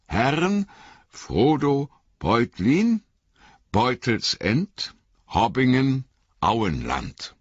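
slow, savouring each word; slow savouring read. A narrator's reading. Innkeeper proudly reading the address to Frodo, slow and savouring. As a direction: read it out slowly, proud he can read. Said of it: "Langsam und genüsslich las er die Adresse vor".

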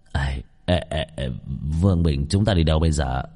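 a hint of old Trần, low and soft; lightly voiced old man. Old Trần asks Vương Bình where they are going, lightly voiced low.

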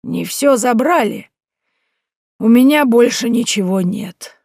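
put-on an old woman who has lost everything, drawn out; tired old woman, pitched high and slow; weary old woman. Old woman, bitter and detached.

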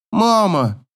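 lightly voiced daughter calling Mum; daughter's murmur. A narrator's reading. Daughter appeals to mother, high murmured plea. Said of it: "бормочет дочка".

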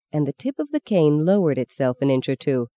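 ordinary delivery

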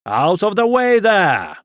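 high, loud long-drawn shout; bawling coachman. A narrator's reading. Coachman clearing the lane, bawling. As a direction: bawl it over the crowd. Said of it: "bawled a coachman towering high".

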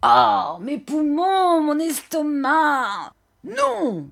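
high, loud, drawn-out lament and refusal; old man's wailing cry. A narrator's reading. Old man rejects the price, high wailing cry. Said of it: "cria le vieillard".

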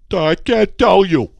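loud, high, drawn-out mock helplessness; mocking imitation. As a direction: imitate a stumped opponent, slow and loud.

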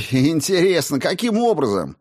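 high-pitched mocking question; exaggerated sarcastic curiosity. A narrator's reading. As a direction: feign great interest, sarcastically. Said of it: "саркастично спросил Соловей".

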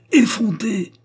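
high, loud insult; scandalised angry exclamation; furious outcry. A narrator's reading. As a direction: hurl it in outrage. Furious mother before slapping daughter.

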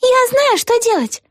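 put-on very high girlish exclamation; excited cry. A narrator's reading. Vega jumping up with an idea, high excited cry. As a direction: cry it out high, like an eager little girl. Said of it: "закричала она".

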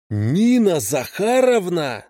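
high, slow, drawn-out yell. Acted. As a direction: bellow her name into the phone. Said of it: "завопил Петрович в трубку".

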